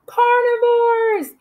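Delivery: high, slow, sing-out cheer; calling out answer. Shown as a demonstration. Teacher answers with the children, calling out the word. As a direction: call it out long and high, for a class.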